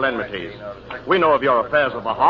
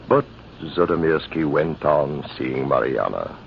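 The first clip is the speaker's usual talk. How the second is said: lower, measured memoir voice; story narration; 9 semitones lower, 4 dB quieter than usual. A narrator's reading. Old officer recalling the garrison feud, measured narration. Narrator recounts past events.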